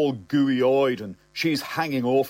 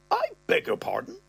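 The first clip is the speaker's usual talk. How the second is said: much higher, outraged; scandalized squeak; shocked father. Retold or imitated. Father told to get tattoo, much higher shocked voice.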